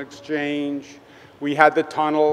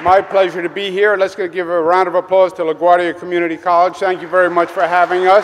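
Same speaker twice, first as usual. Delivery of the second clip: loud, raised public-address voice; calling out to crowd; 4 semitones higher, 8 dB louder, faster than usual. Real, not acted. Governor thanking a cheering college audience, loud and projected. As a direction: project high and strong over applause. Greeting a crowd over applause.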